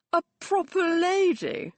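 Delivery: proper lady? jumps high and loud, disbelieving; scoffing high echo of proper lady; incredulous echo. A narrator's reading. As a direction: throw proper lady back in scorn.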